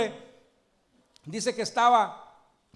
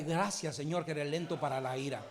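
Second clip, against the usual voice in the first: softer, lower prayer said quickly, as the model for the congregation; quiet prayer; 6 semitones lower, 9 dB quieter, faster than usual. Shown as a demonstration. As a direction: murmur the thanks softly, almost to yourself. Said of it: "Dígale". (oración callada y rápida, más baja y suave)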